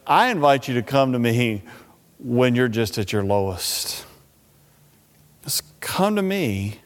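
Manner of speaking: hushed, low reassurance; tender invitation. Acted. Preacher as Jesus inviting people at their lowest, tenderly.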